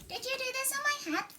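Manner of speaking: high squeaky mole voice; a small, put-out mole; little mole. Acted. Mole demanding who dirtied his head, high squeak. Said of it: "he asked the horse".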